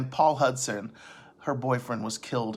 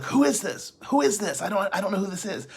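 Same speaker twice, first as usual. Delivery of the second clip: high, fast and jumpy, a man who's lost it; frantic paranoid voice; 7 semitones higher, faster than usual. Retold or imitated. A dealer startled by a knock at his door, high and frantic. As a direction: play the crazed dealer panicking at the knock.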